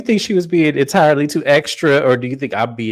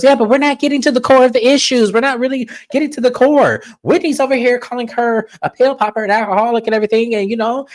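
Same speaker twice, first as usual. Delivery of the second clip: high, rapid castmate imitation; piping up; 10 semitones higher, faster than usual. Host mimics castmate pushing for the core issues, high and fast. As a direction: jump in high-pitched and insistent, rattling it off. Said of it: "Lisa pipes up and she goes".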